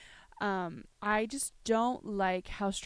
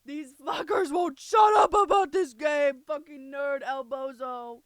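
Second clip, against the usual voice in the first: raised, loud mock complaint, easing off; hammed-up exasperated grumbling; mimicked grumpy rant; 3 semitones higher, 6 dB louder than usual. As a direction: rant as a fed-up character. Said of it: "He's like".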